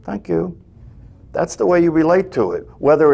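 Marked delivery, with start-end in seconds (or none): 0.0-0.6 gentle, lingering thank-you; tender thanks. Shown as a demonstration. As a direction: thank the mind like soothing a child. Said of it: "So you".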